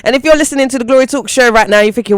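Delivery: loud upbeat radio host intro; show host announcer. Acted.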